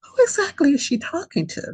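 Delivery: high, puzzled wondering aloud; incredulous. Retold or imitated. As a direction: ask it in baffled disbelief. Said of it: "and I was like".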